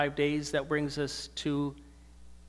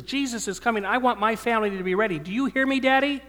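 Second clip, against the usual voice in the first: put-on higher, louder urgent child voice, rising to a cry; a small girl insisting at the dinner table; little daughter preaching; 7 semitones higher, 7 dB louder than usual. Father voicing his daughter urging the family at dinner, in a high urgent child voice. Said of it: "But she says".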